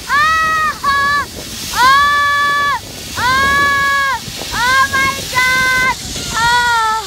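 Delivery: high, long, sustained cries; screaming on zipline. Real, not acted. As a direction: call out high, stretching each cry. First zipline ride, screaming. (pasigaw na tuwa habang nasa zipline)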